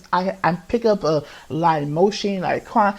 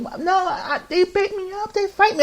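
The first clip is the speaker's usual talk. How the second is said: much higher, laughing plea; high pleading; 13 semitones higher than usual. Retold or imitated. Boy pleading with the teacher, high and half laughing. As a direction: squeak it out like a wronged kid.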